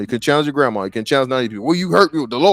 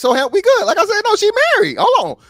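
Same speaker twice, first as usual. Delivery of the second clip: excited; 10 semitones higher, faster than usual. Real, not acted. Excited counter-argument in debate.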